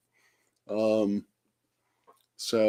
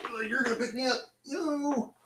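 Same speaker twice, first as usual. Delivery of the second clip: much higher squeaky cat voice, softer; voicing the cat; 6 dB quieter than usual. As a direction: speak for the suspicious cat in a tiny high voice. Voicing the cat's reply.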